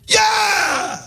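high, shouted yay; big excited cheer. Shown as a demonstration. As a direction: cheer it out with joy. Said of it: "Yay should be like".

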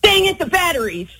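a quick annoyed outburst at yourself; exasperated. Shown as a demonstration. Caller showing the host how she wants it said, exasperated. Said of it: "what do you say and how do you say it".

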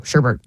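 "sherbet" as SHER-bert, second R sounded, low; sherbet with added R. Shown as a demonstration. Co-host admits her habit, sherbet said SHER-bert. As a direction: say "sherbet" with an R before the T. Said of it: "I actually added an R too".

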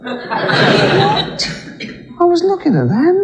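very high squeaky God; mock outraged God squeaking. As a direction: play God as a high-pitched fussy bloke. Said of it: "He goes".

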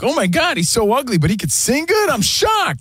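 high gushing surprised voice; mock shocked gasp. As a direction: gush in high fake shock. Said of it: "when are we gonna get over".

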